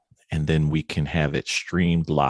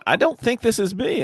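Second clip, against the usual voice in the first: high, puzzled mock voice of the stranger; confused stranger quote. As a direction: play the baffled guy reading the text. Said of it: "He's sitting there going".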